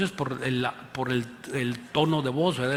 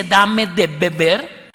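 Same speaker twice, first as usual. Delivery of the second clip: "Dame de beber" slow and louder; emphatic quote; 7 dB louder, much slower than usual. Acted. Preacher quoting Jesus to the congregation, slow and louder. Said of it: "el que te dice".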